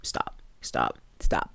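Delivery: whispered, urgent stops; A D pleading. Retold or imitated. Host retelling A D hushing her groom at the altar, urgent whisper. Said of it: "A D goes".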